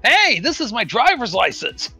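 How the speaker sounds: normal talking voice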